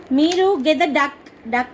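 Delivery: ordinary talk, nothing marked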